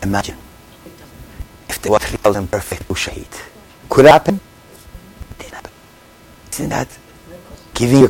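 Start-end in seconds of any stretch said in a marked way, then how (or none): none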